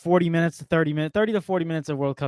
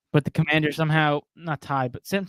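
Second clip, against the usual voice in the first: ordinary delivery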